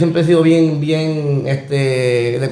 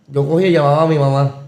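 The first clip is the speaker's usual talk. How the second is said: usual speaking voice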